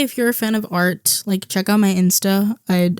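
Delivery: usual speaking voice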